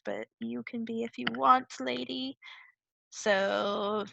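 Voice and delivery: normal talking voice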